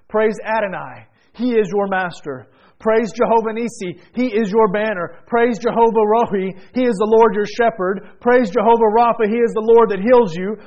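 full-force praise preaching; building preaching. Acted.

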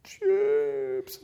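very high little voice; sheepish kid. Retold or imitated. A daughter confessing to her dad. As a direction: admit it like a guilty child.

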